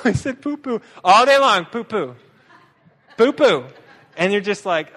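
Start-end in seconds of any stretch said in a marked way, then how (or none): 0.0-3.7 put-on higher, louder silly kid voice with a laugh; higher silly child chanting; giggly child. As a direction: play the kid delighted with a rude word. Child repeating joke, laughing.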